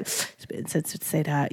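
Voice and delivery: timid soft correction. Retold or imitated. Recalling her old timid self, she corrects someone softly. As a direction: tiptoe around calling it out. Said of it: "back in the day, I'd be like".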